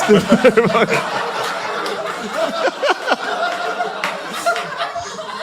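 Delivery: long high cackle; high laugh. Real, not acted. Comic cackling after his crude punchline, high laugh. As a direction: cackle at your own line.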